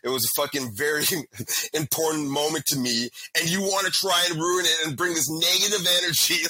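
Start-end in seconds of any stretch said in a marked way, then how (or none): 3.4-6.4 louder, higher indignant rant; fired-up complaint about the rude match; heated rant. Retold or imitated. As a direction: vent the outrage at her. Ranting about the Tinder girl.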